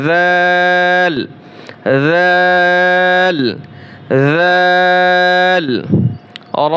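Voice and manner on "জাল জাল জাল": জাল held very long and soft; soft drawn letter. Shown as a demonstration. Teacher showing students a soft, long জাল. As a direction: draw out জাল gently, three alif beats. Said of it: "জাল নরম হবে।".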